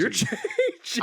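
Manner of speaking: high, slow, laughing through the word; laughing tease. Real, not acted. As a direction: giggle while teasing him. Teasing friend, echoing him.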